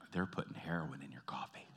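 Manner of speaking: low, whispered comic confidence; hushed aside. Real, not acted. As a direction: drop to a near-whisper, like sharing a secret. Mock secret about addictive coffee.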